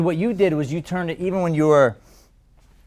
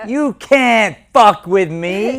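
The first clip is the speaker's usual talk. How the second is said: put-on belligerent drunk; 10 semitones higher, 6 dB louder than usual. Shown as a demonstration. Coach and student trade tough lines.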